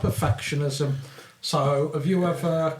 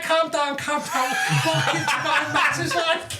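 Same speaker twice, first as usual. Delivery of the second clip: Scouse impression; 11 semitones higher than usual. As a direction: do a hammed-up Scouse footballer. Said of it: "it sounds like Stephen Gerrard".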